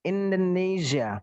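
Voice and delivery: slow "Indonesia" with a zh, "indonezia". Shown as a demonstration. Teacher demonstrates the zh version slowly. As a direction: stretch out "Indonesia" with the zh buzz. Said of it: "Kalau pakai ini nggak enak".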